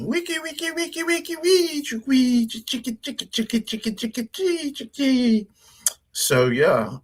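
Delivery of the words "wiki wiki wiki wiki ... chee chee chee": very high pitched vocal record scratching; DJ scratch noises. Shown as a demonstration. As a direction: make turntable scratch sounds up high. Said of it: "M C".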